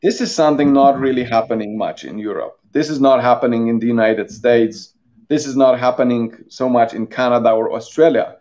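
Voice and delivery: ordinary delivery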